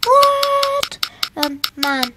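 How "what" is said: high, loud disbelieving what; shocked. Real, not acted. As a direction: yelp it in disbelief. Surprised by game result.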